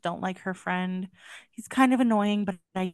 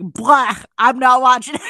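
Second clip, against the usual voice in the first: defiant refusal; 3 semitones higher, 11 dB louder than usual. Retold or imitated. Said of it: "And I was like".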